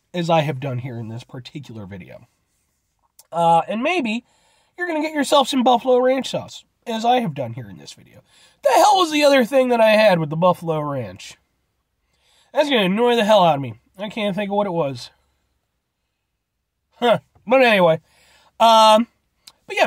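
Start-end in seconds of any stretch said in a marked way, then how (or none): none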